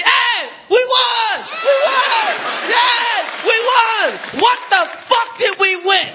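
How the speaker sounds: high, slow jubilant whoop; celebrating. Retold or imitated. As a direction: cheer like you just won big.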